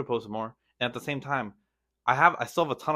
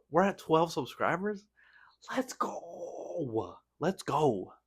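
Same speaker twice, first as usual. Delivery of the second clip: high, breathy excited whisper; 6 semitones higher, much slower than usual. Real, not acted. Vlogger whispering to viewers about twelve subscribers, thrilled whisper. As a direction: whisper the good news like a secret thrill.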